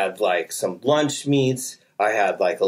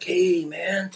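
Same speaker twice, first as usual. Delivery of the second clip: hushed, higher-pitched call; mock distant hail; beckoning; 7 semitones higher than usual. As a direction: imitate a half-whispered call to wave someone over. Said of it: "flagging me in".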